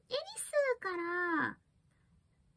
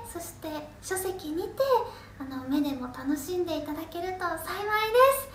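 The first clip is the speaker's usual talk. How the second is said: promo announcement; 12 dB louder than usual. Acted.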